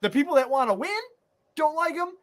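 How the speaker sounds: high-pitched, worked-up ranting; incredulous, high-pitched fan outrage; exasperated rant. Real, not acted. Worked-up rant about the team's leader.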